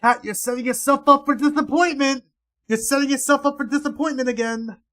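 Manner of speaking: high, loud mocking shout; sing-song yelling warning; loud teasing yell. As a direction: belt it out to taunt her.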